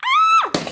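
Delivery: sky-high squealed "ah"; tiny shrill story shriek; high scream. Acted. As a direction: squeal at the very top of the voice. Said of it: "she screamed".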